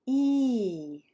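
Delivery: "E" stretched out in a falling arc; long vowel. Shown as a demonstration. Teacher demonstrating long vowel sounds, drawn-out "E". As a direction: hold "E" long, letting the pitch glide down. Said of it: "they're gonna be longer and there's kind of an arc".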